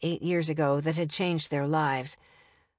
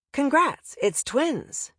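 bright announcement; 7 semitones higher, much slower than usual. A narrator's reading. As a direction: sing out the news. Retold announcement of twins.

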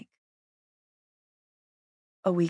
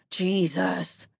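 ordinary delivery, nothing marked

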